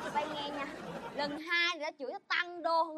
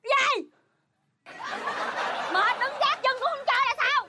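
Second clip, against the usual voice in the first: loud, higher-pitched indignant retort; angry outburst; 5 semitones higher, 4 dB louder than usual. Acted. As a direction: fire back loudly in exasperation. Wife in a cursing fit. (quát lại bực bội)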